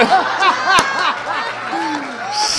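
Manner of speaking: high, loud burst of laughter; instructor's hysterical laugh. Retold or imitated. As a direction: laugh helplessly. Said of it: "she's laughing hysterically".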